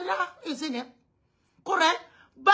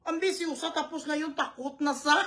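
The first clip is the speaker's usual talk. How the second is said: usual speaking voice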